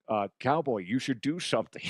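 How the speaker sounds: a hint of a put-on Connery voice; Connery's voice, lightly voiced. Connery nudging his friend to step in, lightly voiced. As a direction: nudge Cowboy in a half-hearted character voice. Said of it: "Connery's like".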